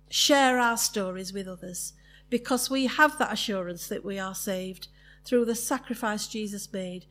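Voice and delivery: soft, earnest closing prayer. Acted. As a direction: pray quietly and sincerely.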